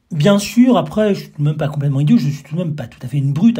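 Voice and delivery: loud, high and fast protest; excited protest. Acted. Guest protesting fast he is no brute. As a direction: rattle it off defensively.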